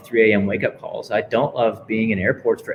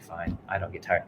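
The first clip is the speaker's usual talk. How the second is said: grudging and much softer, going through the motions; half-hearted mumble; 5 semitones lower, 12 dB quieter than usual. Retold or imitated. Tired runner grudgingly repeats the coach's mantra, half-hearted. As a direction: mutter it like you don't believe it. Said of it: "tell me like you mean it".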